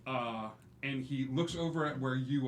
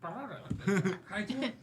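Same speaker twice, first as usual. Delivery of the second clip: a touch of high, laughing squirrel voice; 8 semitones higher, 4 dB louder than usual. Acted. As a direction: play the clueless squirrel lightly. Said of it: "And Dash is like".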